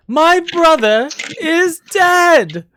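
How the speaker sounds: very high, loud, slow proclamation; outraged shriek. Acted. Judge stands up to deny the testimony, shrieking. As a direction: wail it out word by word in outrage. Jasper protests in court.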